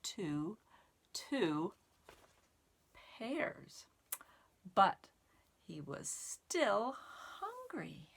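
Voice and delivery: lively storybook read-aloud with counting; reading to children. Acted. Teacher reading the caterpillar book to children, lively storytime voice. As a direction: read it like storytime for little kids.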